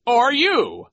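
very high, loud teasing reply; pompous, high-pitched jest; Nero. A narrator's reading. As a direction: toss it back mockingly. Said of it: "Nero said".

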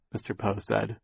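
usual speaking voice

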